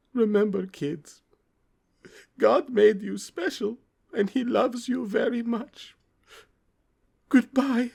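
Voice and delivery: high, slow sad farewell; tender goodbye to the kids, slowly and high; sad Larry. Acted. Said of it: "in a sad voice".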